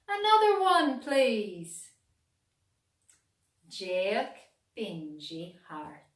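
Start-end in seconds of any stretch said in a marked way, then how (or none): 0.1-1.9 higher, louder eager request; crowd calling. A narrator's reading. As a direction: call out to the musician. Crowd asks for more.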